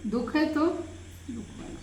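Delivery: ordinary delivery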